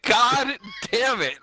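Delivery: slow, high mock-exasperated god damn it; exasperated groan. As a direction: drag it out in mock despair. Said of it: "I was like".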